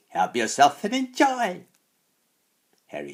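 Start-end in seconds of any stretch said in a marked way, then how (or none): 0.1-1.7 high, loud mocking witch; sniggering echo. A narrator's reading. Second hag echoing the taunt at the boy, high snigger. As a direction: echo it back with a crone's snigger. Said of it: "Hairy Cheeks echoed, sniggering in her turn".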